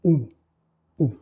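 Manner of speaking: isolated "ou" as a pure oo; rounded teacher's model of "ou"; demonstrated vowel ou. Shown as a demonstration. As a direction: say "ou" with lips pushed forward. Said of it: "la projection des lèvres en avant".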